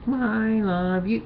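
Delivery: high, slow, gushing "I love you"; mock tender stuffed animal; cooing love line. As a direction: coo it like a lovesick plush toy.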